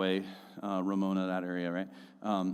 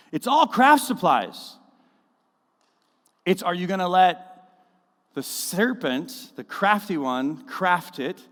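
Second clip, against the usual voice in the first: preached loud and high, pressing the point; fiery preaching; 6 semitones higher, 6 dB louder, slower than usual. Acted. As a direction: drive it home to the congregation. Preaching on craft supplies.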